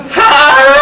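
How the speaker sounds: a sudden jump way up in pitch, squeaked out; nervous high voice. Retold or imitated. Nervous at his first pitch, squeaking high. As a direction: squeak the word like a scared first-timer. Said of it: "I was all ... in my voice".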